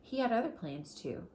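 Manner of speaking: ordinary talk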